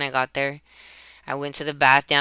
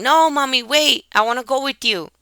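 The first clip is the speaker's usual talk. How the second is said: put-on high, louder child's plea to Mommy; mock yell, pitched way up; imitated yell; 10 semitones higher, 4 dB louder than usual. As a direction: call out urgently, imitating an upset child. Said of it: "came yelling".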